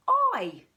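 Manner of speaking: Y said as a loud, high "I"; long I sound. Shown as a demonstration. Teacher sounding out the letter Y as "I". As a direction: sound out "I" clearly for the class. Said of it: "the letter Y says".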